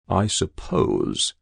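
slow, hesitant. Acted. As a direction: draw it out slowly. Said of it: "said the adjutant slowly".

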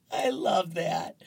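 usual speaking voice

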